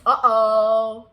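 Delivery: usual speaking voice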